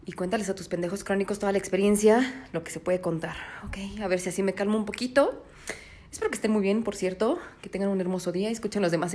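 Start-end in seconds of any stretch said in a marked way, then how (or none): 2.5-5.4 soft whisper to calm down. Real, not acted. Calming herself after the movie, whispering. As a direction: whisper it to settle yourself. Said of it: "relaxation time. Respira".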